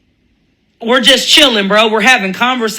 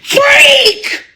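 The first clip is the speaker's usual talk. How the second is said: mock yell. Shown as a demonstration. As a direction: scream "Freak!" at the top of your voice.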